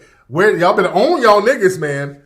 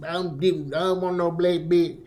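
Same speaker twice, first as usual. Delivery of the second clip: softer, mumbled imitation of a Florida Caribbean accent; mock Florida accent; 7 dB quieter than usual. Host showing those kind of accents he never heard growing up, mock Florida accent. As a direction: put on the broken-English Florida accent, quietly. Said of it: "those kind of accent".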